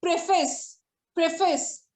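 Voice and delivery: preface said PREF-uhs; correct pronunciation. Shown as a demonstration. Teacher gives the proper way to say preface. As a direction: say preface stressing PREF, short second vowel. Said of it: "and the pronunciation is".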